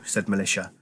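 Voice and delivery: ordinary delivery, nothing marked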